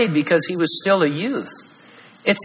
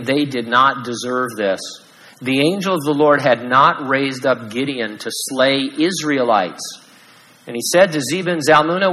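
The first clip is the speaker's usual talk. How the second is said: ordinary delivery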